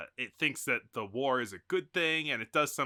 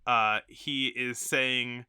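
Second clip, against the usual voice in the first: normal talking voice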